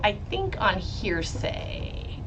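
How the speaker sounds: usual speaking voice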